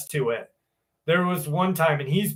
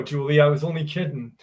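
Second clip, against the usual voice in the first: ordinary talk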